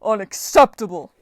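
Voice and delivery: loud giant's roar of refusal; booming, indignant shout; bellow. A narrator's reading. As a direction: bellow it in outrage. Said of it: "the giant bellowed".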